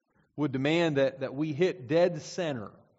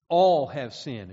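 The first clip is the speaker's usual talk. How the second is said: ALL hit loud and slow at the front; All said loud and slow, as if it ended in an exclamation point; emphatic all; 7 dB louder, slower than usual. Shown as a demonstration. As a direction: punch the word All like an exclamation. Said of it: "moved the word they wished to emphasize to the front".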